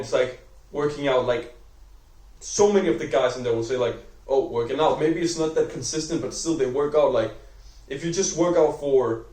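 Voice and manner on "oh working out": ordinary delivery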